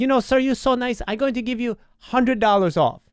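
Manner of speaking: put-on high, sweet Filipino accent offering a discount; Filipino accent impression. Filipino agent rewarding the nice caller, in a high Filipino accent. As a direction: imitate the agent's delighted Filipino lilt. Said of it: "an impression of a Filipino lady".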